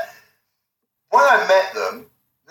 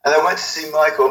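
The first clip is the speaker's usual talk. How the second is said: usual speaking voice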